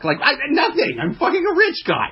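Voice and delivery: airy high mock trophy wife; high gold digger voice. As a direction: voice her smug and high.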